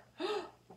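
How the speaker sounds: sharp sudden in-breath; shocked gasp. Acted. Reader gasping as Maria realizes the ring is gone, sudden gasp.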